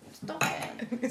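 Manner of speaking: usual speaking voice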